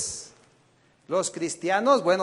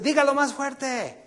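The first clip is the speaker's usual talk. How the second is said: higher, louder, slow crowd-pumping demand; preacher imitating a motivator demanding more noise, higher and louder; mock hype shout; 8 semitones higher, 5 dB louder than usual. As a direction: egg the crowd on to yell. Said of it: "Y luego dicen".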